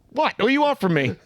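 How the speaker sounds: high-pitched mock protest; mock exasperation. Defending himself to his friends, mock-exasperated. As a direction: start high and slide down, pleading in jest.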